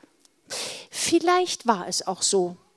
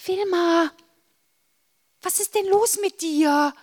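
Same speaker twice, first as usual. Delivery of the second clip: worried high question; 9 semitones higher, 3 dB louder than usual. Acted. Worried sister asks at night.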